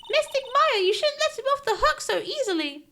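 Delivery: put-on high childish voice chiding; little girl scolding. Pearl scolding voice.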